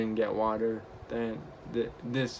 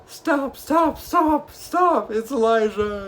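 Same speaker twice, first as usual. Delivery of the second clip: high, loud imitated yell from the tram; 11 semitones higher, 6 dB louder, slower than usual. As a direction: shout after the tram as the crowd. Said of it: "everyone on the tram is yelling".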